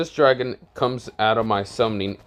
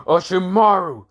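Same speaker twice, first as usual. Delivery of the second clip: higher, louder creature voice telling off its master; angry snake; 8 semitones higher, 5 dB louder than usual. Acted. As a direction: yell at your master in a monster voice. Snake refuses to fight.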